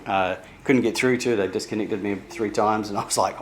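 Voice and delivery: ordinary talk, nothing marked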